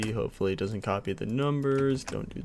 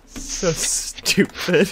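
breathless laugh; 6 semitones higher, 6 dB louder, much slower than usual. Real, not acted. Laughing at mistake.

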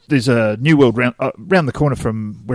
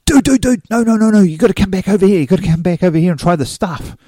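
urgent calling back; 7 semitones higher than usual. Retold or imitated. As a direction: imitate an excited friend calling someone back. Urgently calls the speaker back over.

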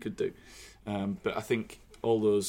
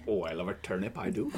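put-on lightly done Norfolk farmer accent; a touch of Norfolk farmer voice; 5 semitones lower, 7 dB quieter than usual. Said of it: "It's like your Norfolk voice".

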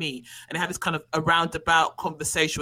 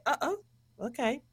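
small, high, soft mock-uneasy reaction; higher and quieter, comically uncomfortable; awkward surprise; 5 semitones higher, 5 dB quieter, faster than usual. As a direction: react sheepishly to an unexpected turn. Acts own awkward reaction to scene.

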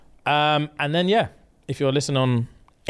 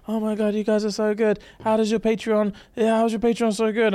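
fawning Patreon staff; 8 semitones higher than usual. Retold or imitated.

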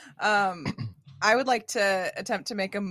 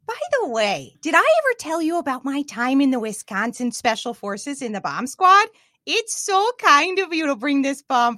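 higher, louder, more animated read; re-delivered in character; 6 semitones higher, 4 dB louder than usual. Shown as a demonstration. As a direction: ham up the character line. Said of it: "I'll re-deliver the line now that it's set".

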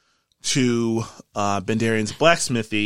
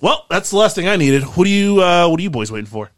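raised, louder character voice; Wildclaw, brisk and hearty; 7 semitones higher, 8 dB louder, faster than usual. Acted. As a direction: hurry the boys along heartily. Game master plays Wildclaw.

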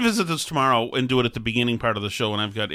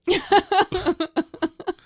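real burst of laughter, pitched up high. Real, not acted. Laughing at the girl's comment.

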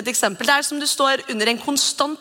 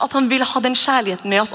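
ordinary talk

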